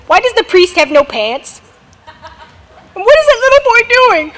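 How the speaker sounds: ordinary talk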